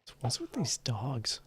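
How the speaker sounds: normal talking voice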